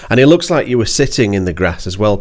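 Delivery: normal talking voice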